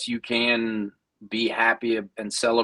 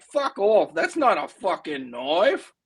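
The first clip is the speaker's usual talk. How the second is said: amateur Aussie accent, higher on the opening swear; 5 semitones higher, slower than usual. Shown as a demonstration. Showing co-host a more vulgar take, in an Aussie accent. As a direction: put on a vulgar Aussie movie-tough-guy accent. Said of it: "mid-range on the Aussie accents".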